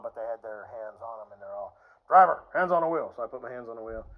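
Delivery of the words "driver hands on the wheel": loud, sharp order to a driver; police command. Retold or imitated. Cops ordering the boxed-in driver, loud command. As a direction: call it out like a cop.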